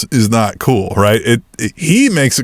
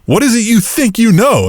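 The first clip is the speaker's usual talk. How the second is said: high, exasperated mock Miz; 7 semitones higher than usual. Retelling Miz demanding answers, high and exasperated. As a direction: whine it like a fed-up Miz. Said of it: "And Miz is like".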